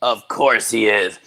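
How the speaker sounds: ordinary talk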